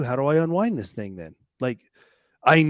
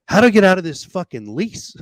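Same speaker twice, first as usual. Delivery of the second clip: louder, higher desperate plea; exasperated outburst; 6 semitones higher, 8 dB louder than usual. Retold or imitated. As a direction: blurt it out, fed up and cornered. Asking God about the lease.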